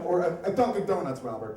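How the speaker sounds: normal talking voice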